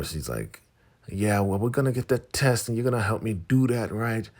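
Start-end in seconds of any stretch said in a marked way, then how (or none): 1.1-4.3 high, loud, pushy caricature; Elder Mother Mava. Retold or imitated. Elder pressing Daikon to help, high pushy voice. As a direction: insist in a high put-on voice. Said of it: "Elder Mother Mava".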